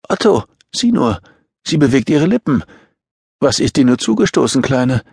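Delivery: high-pitched, agitated woman; urgent concern. A narrator's reading. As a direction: exclaim with concern, pitch well up. Woman alarmed over injured child.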